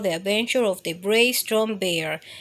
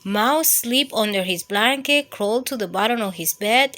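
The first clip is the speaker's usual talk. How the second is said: ordinary delivery, nothing marked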